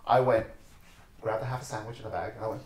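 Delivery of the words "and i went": usual speaking voice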